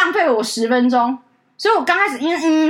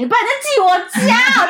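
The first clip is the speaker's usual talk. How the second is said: high, loud mock sneer; sarcastic retort; 9 semitones higher, 4 dB louder, slower than usual. Real, not acted. Assistant mocking caller's obvious question, high and sarcastic. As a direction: throw back a biting sarcastic jab.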